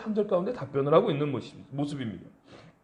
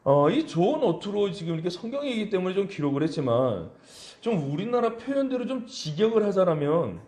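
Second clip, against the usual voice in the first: ordinary talk, nothing marked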